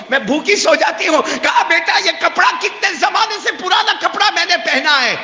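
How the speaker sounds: high, crying plea with catching breath; a mother telling her sacrifices through tears; tearful mother. Retold or imitated. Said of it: "ماں نے روتے روتے کہا".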